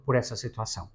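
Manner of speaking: ordinary talk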